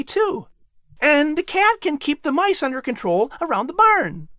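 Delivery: put-on high, bright young boy's voice; a keen son offering to help; boy asking his mother eagerly; eager boy Patrick. Patrick pitches his idea.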